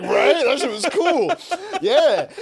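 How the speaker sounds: high, loud, laughing brag; proud laughing. Retold or imitated. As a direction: laugh through the boast. Proudly agreeing with therapist.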